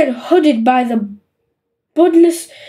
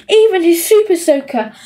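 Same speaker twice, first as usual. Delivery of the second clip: plain reading voice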